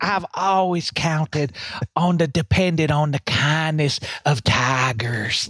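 slow Southern belle drawl; Streetcar Southern belle. Retold or imitated. Host parodies the coach as a Streetcar character, slow drawl. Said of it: "how much he sounds like a character from Streetcar".

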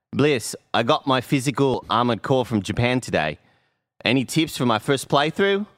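put-on broad Kiwi accent; New Zealand accent. Host reading a New Zealand viewer's message, Kiwi accent. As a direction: read it as a thick New Zealander. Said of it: "He's done someone from New Zealand".

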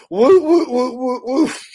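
high yelping woofs; high, loud puppy yelps; high mock barking. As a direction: yelp like a little dog.

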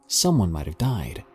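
plain reading voice

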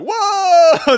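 high, loud drawn-out whoa; amazed whoa. Retold or imitated. Audience watching the granny start locking, high loud amazed whoa. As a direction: gasp out whoa in delight.

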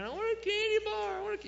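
put-on high-pitched whiny toddler wail; crying little boy. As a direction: mimic a small child crying for candy. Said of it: "like, crying".

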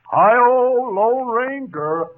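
Silver's shout. Retold or imitated. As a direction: bellow it out like the Lone Ranger's cry. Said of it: "You don't hear Silver shout".

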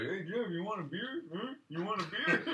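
high, lightly voiced offer from the dad; a light impression of the dad pushing beers; imitating girlfriend's dad. Comedian imitating his girlfriend's dad offering a beer, lightly voiced. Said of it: "That's how he sounds".

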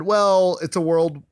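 normal talking voice

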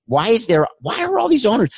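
high startled squeak; surprised. Acted. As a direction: jump up in pitch, caught off guard. Caller startled by owners on call.

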